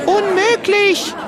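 very high, loud squeal; disbelieving shout. Acted. Another spectator doubting the claim, piercing cry of disbelief. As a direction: shriek it out, stretched and incredulous. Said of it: "die Leute rufen".

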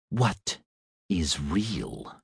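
soft, hushed inner whisper. A narrator's reading. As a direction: breathe the question like a voice in the mind. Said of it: "The words whispered in the robot's old brain".

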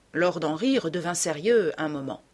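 plain reading voice

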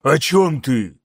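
ordinary delivery, nothing marked

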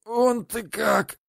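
slow high wheeze; wheezing. Acted. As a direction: wheeze it, winded. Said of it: "сипел он".

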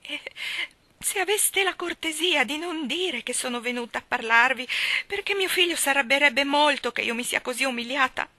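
high, loud, quavering plea; trembling voice. A narrator's reading. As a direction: let the voice tremble with shame. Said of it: "La voce della povera missis Tulliver tremava". (voce tremante e supplichevole)

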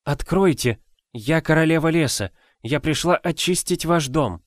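high, smiling seductive call; charming female voice. A narrator's reading. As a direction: lure them with a sweet smile. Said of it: "Женский голос, в котором слышалась очаровательная улыбка". (очаровательный женский голос)

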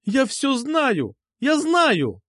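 put-on high, drawn-out girlish outcry; insistent high-pitched accusation; shouted out. A narrator's reading. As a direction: cry it out high, stretching each word. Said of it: "выкрикнула она".

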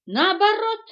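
high, very loud retort; indignant objection. A narrator's reading. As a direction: object at the top of the voice. Monkey objecting to being silenced. (возмущённо, громко)

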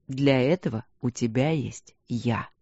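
low, slow, steady husband's voice; husband calmly comforting his worried wife. Acted. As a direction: reassure her, calm and low. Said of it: "спокойно произнёс муж".

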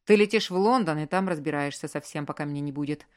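usual speaking voice

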